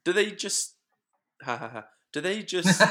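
giggling through the question; breaking into laughter; laughing. Real, not acted. Laughing at own silly question.

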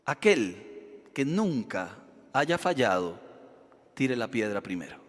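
quiet, slow words of Jesus; solemn and calm. Acted. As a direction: deliver it gently, with grave authority. Jesus's solemn answer to the crowd.

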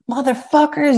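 high-pitched imitation of a yelling inmate; mock yelling. Inmate raging in the next cell, imitated yelling. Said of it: "He's just yelling at him".

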